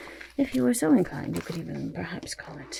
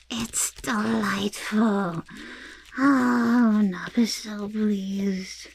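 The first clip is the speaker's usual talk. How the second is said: slow, drawn-out self-satisfied delight; theatrical delight; 4 semitones higher, 5 dB louder, much slower than usual. Acted. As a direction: savour every word, hugely pleased. Character delighted with own work.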